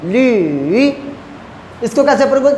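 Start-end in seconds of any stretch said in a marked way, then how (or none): 0.0-0.9 ली falling then rising; teacher demo of ली in third tone. Shown as a demonstration. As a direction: dip ली low then lift it. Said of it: "फिर मैं rising की तरफ जा रहा हूँ".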